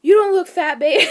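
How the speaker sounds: very loud, high imitation of husband; hammed-up hasty backpedal; blurted reassurance. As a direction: burst out with a mock-desperate reassurance.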